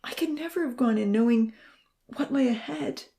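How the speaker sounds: high, strained voice, hushed in places; choked out. A narrator's reading. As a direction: force the words out through tears. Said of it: "he choked out".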